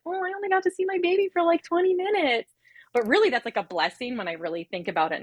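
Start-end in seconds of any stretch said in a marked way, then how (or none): 0.0-2.5 put-on high, fast childish whine; mock pouting complaint, pitched way up; high, quick, whining voice of a disappointed mom-to-be; whiny self-quote. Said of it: "And I was like".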